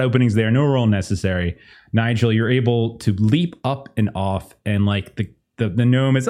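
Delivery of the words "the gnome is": ordinary talk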